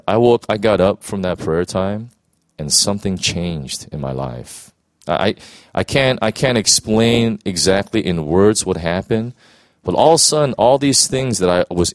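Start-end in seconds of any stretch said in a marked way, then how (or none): none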